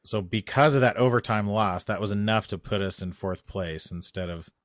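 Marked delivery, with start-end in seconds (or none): none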